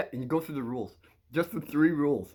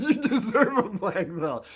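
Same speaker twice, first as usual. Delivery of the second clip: higher, slow words through laughter; cracking up; 5 semitones higher than usual. Real, not acted. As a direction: giggle helplessly through the quote. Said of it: "I'm just trying to keep a straight face".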